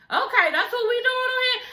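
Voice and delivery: high, laughing mock-shocked Paris; imitating Paris. Voicing Paris reacting to Erica, high and laughing. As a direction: squeak it out like a shocked friend in disbelief. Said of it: "Paris's face looking like".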